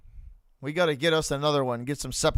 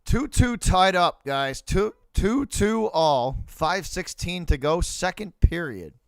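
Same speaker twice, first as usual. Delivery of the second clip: score announcement; 3 semitones higher, slower than usual. Acted.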